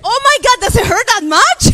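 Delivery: high, loud comic alarm; hammed-up shocked exclamation; alarmed reaction. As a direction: replay a mother's startled outburst. Mother's alarm at child's crying.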